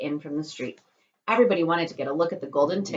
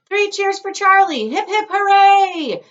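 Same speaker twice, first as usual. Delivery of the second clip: high, loud, slow cheer; 12 dB louder, slower than usual. Acted. Grandpa Joe cheers for Charlie, high, loud and slow. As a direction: lead the hooray.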